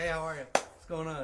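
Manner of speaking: loud cheery greeting; mock friendly greeting. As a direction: greet an empty room cheerily.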